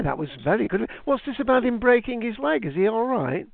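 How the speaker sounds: ordinary talk, nothing marked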